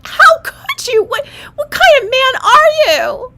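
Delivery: put-on high, loud wounded baby voice; betrayed newborn. Newborn accusing the doctor, high and hurt. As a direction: wail it like a betrayed infant.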